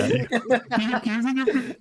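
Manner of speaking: high goofy echo; high squeaky echo of the joke; silly echo. Acted. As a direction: parrot the silly voice. GM echoes silly voice.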